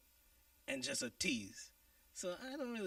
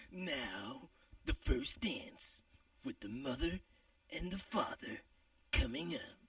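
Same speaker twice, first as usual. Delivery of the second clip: slow, drawn-out emcee call; strip club announcer voice; slower than usual. Shown as a demonstration. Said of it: "what are you talking like that for".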